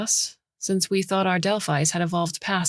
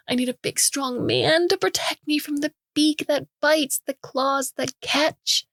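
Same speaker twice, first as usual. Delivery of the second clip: higher, slow, simpering helpless maiden; mock damsel; 9 semitones higher than usual. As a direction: flatter him like a helpless damsel. Mock damsel pleading to Gray.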